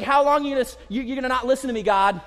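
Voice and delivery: loud, high indignant demand; attitude with God. Retold or imitated. A believer snaps at God for not listening, indignant. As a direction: talk back to God with attitude. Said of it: "You ever get an attitude with God?".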